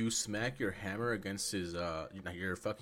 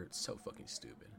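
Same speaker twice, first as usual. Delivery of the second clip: whispered gripe; 10 dB quieter than usual. Real, not acted. Muttered complaint about the plot.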